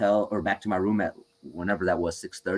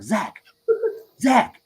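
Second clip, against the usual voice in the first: calling out; 12 semitones higher, 3 dB louder than usual. Retold or imitated. Friend passes out suddenly.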